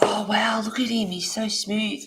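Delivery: neighbour's hushed admiration; impressed neighbour. Retold or imitated. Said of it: "she's like".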